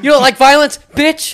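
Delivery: high, loud mock threat; a hammed-up bar brawler; mock tough guy. Teasing a friend, playing a tough guy, loud and high.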